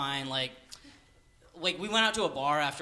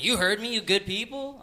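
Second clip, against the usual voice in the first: mock challenge, higher and louder; insistent repeat; 7 semitones higher, 6 dB louder than usual. Drunk stranger repeats his question to comic, insistent. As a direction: press the question, pitched up and louder.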